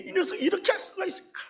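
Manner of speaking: hot, rushing protest at the betrayal; indignant outcry. Retold or imitated. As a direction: fling the question out in disbelief.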